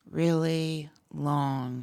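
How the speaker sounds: slow, stretched words; drawn out. Shown as a demonstration. Wife describing a voice, words drawn out slow. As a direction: draw every word out long. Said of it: "his words were just".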